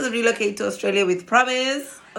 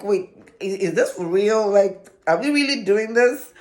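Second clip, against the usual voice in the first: disbelief; 3 dB louder than usual. Retold or imitated. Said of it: "when you wanted something for so long".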